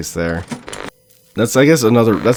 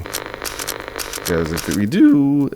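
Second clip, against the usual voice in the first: voice crack; 4 semitones lower, much slower than usual. Real, not acted. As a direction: voice cracking mid-phrase. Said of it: "oh, voice crack".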